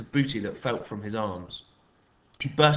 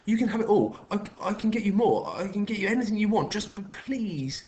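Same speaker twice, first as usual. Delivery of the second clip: tearful pleading; 10 semitones higher than usual. A narrator's reading. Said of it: "tears filling his eyes".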